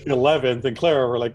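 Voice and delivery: ordinary talk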